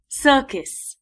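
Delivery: ordinary delivery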